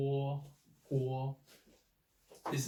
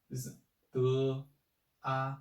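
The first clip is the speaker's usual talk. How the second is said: usual speaking voice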